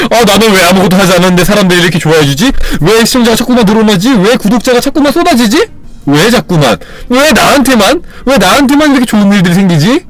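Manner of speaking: tongue-in-cheek delighted wonder; gleeful mock boast. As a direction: brag playfully as if luck won't stop. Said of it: "긍정적인 농담들을".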